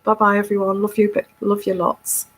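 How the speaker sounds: ordinary talk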